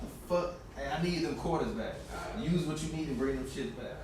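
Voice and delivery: whispered under the breath so the guy at the door won't hear; hushed whisper to a friend while someone's at the door. Real, not acted.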